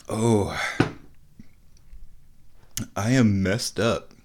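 ordinary delivery

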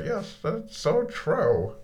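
high, slow voice for the cat; playful high cat voice; cat's voice. Pretend. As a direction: answer for the cat in a high sweet voice. Voicing the cat's reply.

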